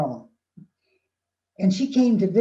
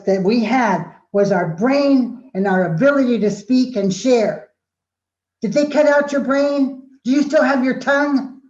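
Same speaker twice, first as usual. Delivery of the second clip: high, loud impassioned tirade; acted-out student outburst at full volume; shouted confrontation; 6 semitones higher, 7 dB louder than usual. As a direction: shout it at the teacher, demanding answers.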